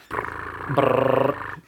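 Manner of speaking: lips trilled like a drum roll. Acted. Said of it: "drum roll".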